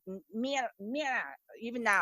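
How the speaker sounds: soft, halting try at "mira" with the R unrolled; failed rolled R. Shown as a demonstration. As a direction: struggle quietly to say "mira", R staying flat. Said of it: "I can't say".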